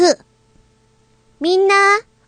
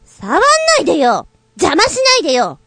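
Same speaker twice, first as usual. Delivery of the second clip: angry yelling; 5 semitones higher than usual. Acted. Sister yells at her sister not to touch her. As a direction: shove her off with your voice.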